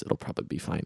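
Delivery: whispered throwaway aside; a quiet joke tossed off under the breath. Real, not acted. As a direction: drop to a near whisper for the aside. Muttered aside after a joke.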